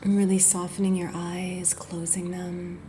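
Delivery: unhurried, gentle body-scan guidance; guided meditation. Acted. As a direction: ease the listener into rest, phrase by phrase. Leads a guided yoga nidra.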